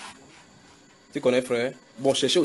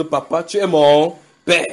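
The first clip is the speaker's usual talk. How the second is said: pleading son; 4 semitones higher, 11 dB louder, slower than usual. Retold or imitated. Voicing man pleading to stranger.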